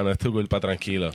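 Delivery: reassuring. Real, not acted. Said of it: "consoling friend".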